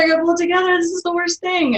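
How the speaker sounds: the self-talk tipping into panic; mock panic. To herself while crying at work, half-played panic. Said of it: "or you're like".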